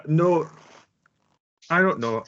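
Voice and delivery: usual speaking voice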